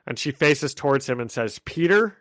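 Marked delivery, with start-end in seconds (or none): 1.6-2.2 mildly puzzled question, pitched up at the end; confused. Retold or imitated. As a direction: ask it as if unsure who is there, lifting the pitch.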